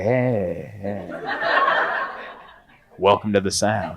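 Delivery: low, cool mock welcome; unwelcoming greeting. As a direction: say welcome like you mean leave. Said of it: "doesn't sound welcoming".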